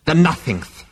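high-pitched, scornful correction; spat out. A narrator's reading. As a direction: spit the title out in contempt. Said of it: "spat the figure".

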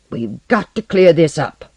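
urgent insistence; insistent. A narrator's reading. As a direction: press the demand firmly and loudly. Porlock insists on investigating.

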